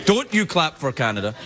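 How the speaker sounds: normal talking voice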